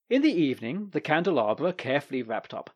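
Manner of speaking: usual speaking voice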